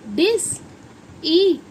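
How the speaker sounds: Discipline in slow, separated syllables. Shown as a demonstration. Teacher showing how letters join, slow syllables. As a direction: stretch and split discipline into its parts. Sounding out the word slowly.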